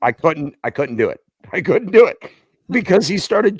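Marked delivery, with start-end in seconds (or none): none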